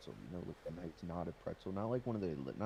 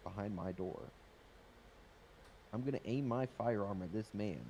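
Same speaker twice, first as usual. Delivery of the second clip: ordinary talk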